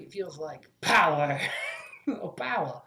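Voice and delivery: slow, relishing, with a breathless chuckle; power-drunk. Acted. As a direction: savour the power like a budding villain. Character seized by the device.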